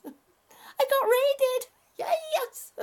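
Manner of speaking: high, loud squeal of delight; excited cheer. Real, not acted. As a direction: whoop it out, drawn out and thrilled. Celebrating a surprise raid on stream.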